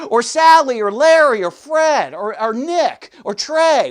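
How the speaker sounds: loud preaching list. Acted. Preacher listing names loudly.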